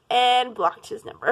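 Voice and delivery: ordinary talk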